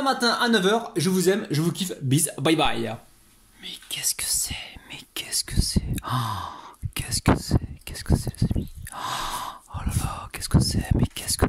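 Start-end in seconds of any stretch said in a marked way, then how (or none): 3.6-11.5 awed whisper. Real, not acted. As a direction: hush it, full of wonder. Whispering over the finished food.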